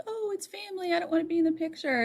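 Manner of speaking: high, soft demurring voice; imitated girlfriend. Girlfriend declining a family photo, imitated high soft voice. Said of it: "Jonathan's girlfriend at the time was like".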